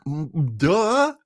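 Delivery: normal talking voice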